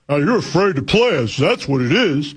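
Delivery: imitating whining fans, swooping pitch; mock the moaning complainers; exaggerated taunting whine. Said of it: "moaning and whining about".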